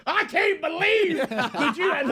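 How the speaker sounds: high, drawn-out exclamation; acted outburst. Re-enacting what woke him up, acted outburst. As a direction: wail it in mock outrage, very high.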